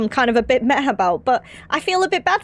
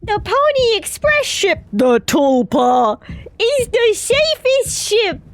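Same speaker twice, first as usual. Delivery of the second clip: high, slow, loud mock of the ship's robot; mocking robot parrot; 7 semitones higher, 3 dB louder, slower than usual. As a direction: parrot the smug robot line back at it. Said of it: "Polly says".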